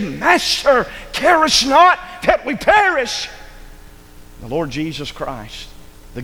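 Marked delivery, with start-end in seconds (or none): 0.0-3.3 high, slow, desperate plea; frightened cry. Acted. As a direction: cry out in terror to the master. Disciples crying out in storm.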